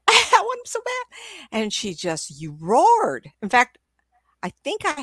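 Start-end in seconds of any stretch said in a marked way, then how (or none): 0.1-1.2 very high whimpering dog; hammed-up doggy begging; dog's desperate craving. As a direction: squeal it like a pining pup. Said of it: "it was the way the dog said it to me".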